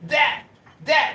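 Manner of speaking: lightly mocked stammering eh and that; imitating Pepe. [name] mocking Pepe's chat voice, light stammering imitation. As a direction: mimic a stuck, looping voice, barely changed. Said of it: "he sounds like".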